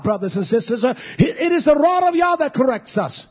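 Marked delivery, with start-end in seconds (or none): 1.2-3.2 shouting the sermon, pitch raised high; preaching at full force. Real, not acted. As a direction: drive it home to the church. Preacher rising to a full cry.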